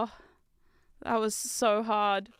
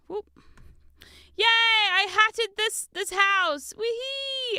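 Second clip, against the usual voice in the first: much higher, louder, slow proud yay; triumphant cheer; 9 semitones higher, 4 dB louder, much slower than usual. Real, not acted. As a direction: crow over the finished roof. Celebrates finishing roof.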